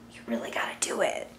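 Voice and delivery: urgent hushed whisper; conspiratorial whispered nudge. Real, not acted. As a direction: lean in and whisper the push. Urging the sign to act.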